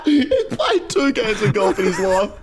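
squeaking words out through laughter; very high, cracking up mid-sentence; can barely talk for laughing; helpless laughter. Real, not acted. Losing it over the meme.

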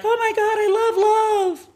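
very high, slow, dreamy gush; swooning gush. Retold or imitated. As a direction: swoon over love like a lovestruck teen. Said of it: "And I was like".

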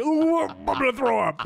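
squealing high ooh of disgust; mock gagging. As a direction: act about to throw up. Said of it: "I'm creeped out".

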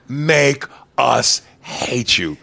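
very slow, word-by-word order; director's demand. Pretend. Host coaching his actor, slow deliberate demand. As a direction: space out every word for weight. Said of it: "like you said with Leonardo".

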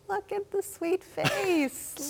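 high, slow, melting coo; overwhelmed by cuteness. Real, not acted. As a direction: swoon over the sweet kitten. Anchor cooing over kitten photo.

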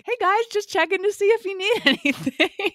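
high-pitched mock dad voice, breaking into laughter; happy-go-lucky dad. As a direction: imitate an over-friendly dad checking on the kids. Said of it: "be that type of dad".

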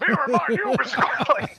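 high-pitched line breaking into laughter; mock aggressive line. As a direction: bark the line out high while cracking up. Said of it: "my line came out, like, super aggressive".

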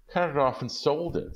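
wife pleading with husband about her hair; Della pleading. Acted. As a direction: plead with your husband to understand. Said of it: "said Della".

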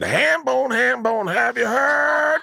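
high shouted Southern hollering; hollered hillbilly call, slow. Retold or imitated. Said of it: "Leanne said to me".

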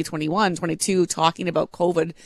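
ordinary talk, nothing marked

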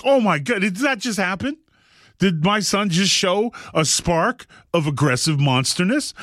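high-pitched, incredulous reaction; amused disbelief. Retold or imitated. Father retelling his shock at his son's outburst, high-pitched astonishment. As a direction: re-enact stunned, delighted disbelief.

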